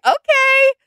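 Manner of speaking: very high loud shocked okay; okay shrieked in disbelief; stunned okay. Retold or imitated. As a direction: squeal out a scandalized okay. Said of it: "I was like".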